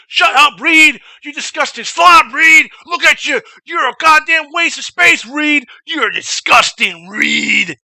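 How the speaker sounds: imitated drill instructor tirade, high and loud; high-pitched, barking rant that drags out at the end; flipping out. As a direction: berate a recruit at a shout. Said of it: "he tries to flip out".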